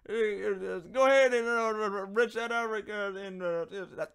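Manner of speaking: high, loud, slow hillbilly Southern drawl; exaggerated Southern relative calling out to Justin; Southern family impression. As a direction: ham up a thick rural Southern accent. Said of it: "They all sound like this".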